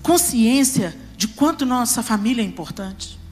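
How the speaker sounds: fervent pulpit preaching; impassioned preaching. Acted. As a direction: drive it home to the congregation. Preaching with rising intensity.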